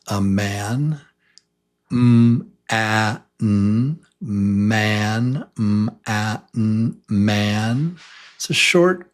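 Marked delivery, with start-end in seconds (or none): 1.9-8.0 m, a, n blended into man; sounding out man. Shown as a demonstration. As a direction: sound it out slowly then blend. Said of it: "a, a man".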